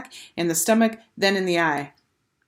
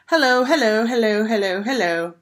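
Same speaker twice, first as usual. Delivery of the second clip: taunting; 5 semitones higher, 5 dB louder than usual. A narrator's reading. Taunting repeated hellos.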